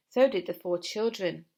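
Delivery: ordinary delivery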